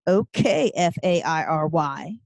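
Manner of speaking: slowly spelled-out taunt through an evil grin; taunting retort. A narrator's reading. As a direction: spell it letter by letter to needle. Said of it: "retorts with an evil grin".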